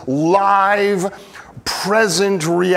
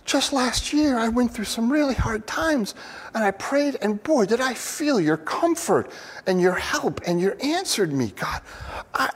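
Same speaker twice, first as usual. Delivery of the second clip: higher, hushed prayer that swells into pleading; higher pleading prayer, mildly acted; acted heartfelt prayer; 8 semitones higher than usual. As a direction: speak to God as a hurting believer. Psalmist praying to God.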